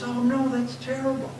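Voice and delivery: high, pitying voice; lady's sympathy. Retold or imitated. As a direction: sound sorry for him.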